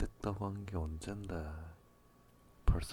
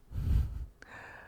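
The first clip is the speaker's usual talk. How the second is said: whispered laugh. Acted. Teasing laugh mid-roleplay.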